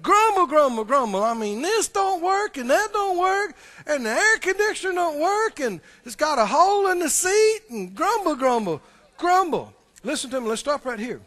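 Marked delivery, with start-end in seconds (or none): 0.0-9.7 mock grumbling. Mock list of complaints.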